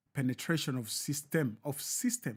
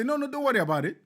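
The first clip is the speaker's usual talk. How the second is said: high, loud mocking brush-off; mock dismissive; 10 semitones higher, 5 dB louder, faster than usual. Friend brushing off the robbed man's panic in a high mock voice. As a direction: wave it away in a high voice.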